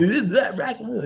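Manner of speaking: high laughing Dana Carvey voice; Dana Carvey impression. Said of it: "Dana Carvey coming in there".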